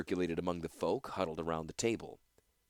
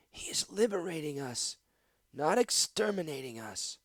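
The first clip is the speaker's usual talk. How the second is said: whispered correction; hushed retort; 8 semitones higher than usual. Acted. Pillow-faced woman whispers back to Gix. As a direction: breathe it as a tight rebuke.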